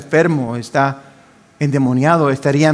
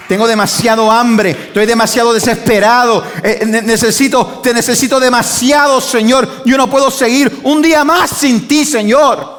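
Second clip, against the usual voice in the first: higher and louder, crying out to God in need; climbing plea, rising higher with each line; desperate pleading cry; 8 semitones higher, 4 dB louder than usual. Retold or imitated. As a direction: beg like someone starving for God. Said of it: "hay aquellos que dicen".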